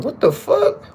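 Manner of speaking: high-pitched baffled reaction; mock bafflement. Listener reacting to the switched accents, mock bafflement. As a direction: act thrown, pitch shooting way up.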